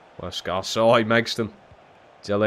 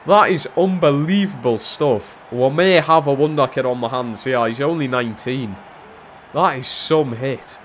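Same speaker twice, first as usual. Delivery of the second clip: loud, raised, gushing praise; excited amazement; 6 semitones higher, 8 dB louder than usual. Real, not acted. Gamer praising his wonder kid's finish, excited. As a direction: marvel loudly at a wonder goal. Marvels at the goal just scored.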